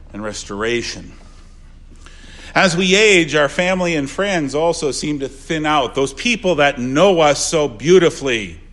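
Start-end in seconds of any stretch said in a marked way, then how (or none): 2.5-8.6 louder pulpit voice; fervent sermon delivery; preaching. Acted. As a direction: lift the congregation's attention. Preaching at full force.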